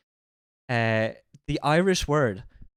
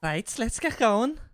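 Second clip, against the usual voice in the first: high, slow imitation of a Scouse accent; 8 semitones higher, slower than usual. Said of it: "putting her accent on a bit".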